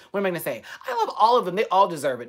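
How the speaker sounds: ordinary talk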